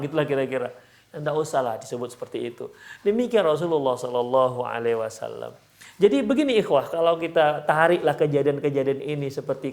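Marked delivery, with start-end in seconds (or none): none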